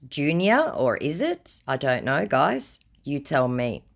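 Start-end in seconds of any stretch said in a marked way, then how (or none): none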